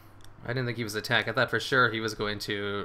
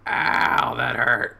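pained yelp; 7 dB louder, much slower than usual. Real, not acted. Reacting to a crit hit.

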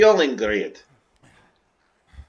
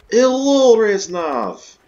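excited greeting; 9 semitones higher, 9 dB louder, much slower than usual. Real, not acted. Gamer greeting the returning Reznov on screen, loud and excited. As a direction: greet him like a long-lost buddy.

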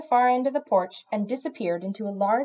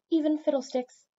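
higher-pitched, firm cat voice; 7 semitones higher than usual. A narrator's reading. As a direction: add it firmly as a settled fact.

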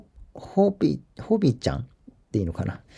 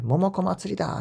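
mock excited cheer; 7 semitones higher than usual. Host quoting excited fans on social media, mock excited cheer. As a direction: imitate fans hailing a festival.

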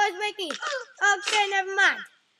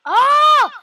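loud, much higher startled cry; startled shout; 9 semitones higher, 14 dB louder than usual. Real, not acted. Player startled by a crash, shouting out. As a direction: jump at the sudden crash.

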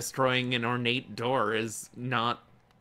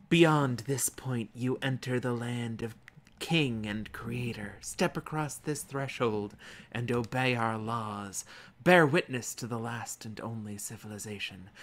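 solemn reading; slower than usual. Acted. Reading in-game inscription aloud.

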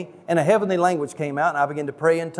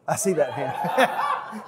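put-on slow, drawn-out child preacher voice with a laugh; mock child preacher altar call; 5 dB quieter, much slower than usual. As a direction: play the boy preacher spotting a raised hand. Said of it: "I even said".

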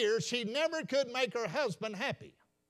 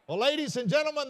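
ordinary delivery

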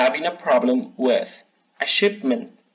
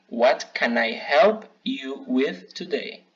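slow, careful model sentence; slow model phrase; slower than usual. Shown as a demonstration. Teacher modelling a phrase for students, slowly. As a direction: say it slowly for learners to copy. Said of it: "Aquí hay algunos ejemplos".

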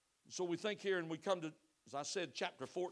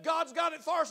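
shouted Caleb; 9 semitones higher, 6 dB louder than usual. Acted. Caleb voiced in the story.

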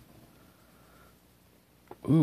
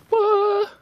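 a sudden, much higher and louder "What?"; shocked squeak; 5 dB louder than usual. Real, not acted. To herself after guessing an ink, a shocked high yelp. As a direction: yelp it out in disbelief.

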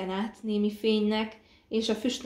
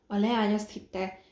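ordinary delivery

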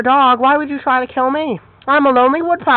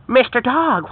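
ordinary talk, nothing marked

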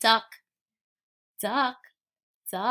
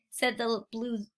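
ordinary delivery, nothing marked

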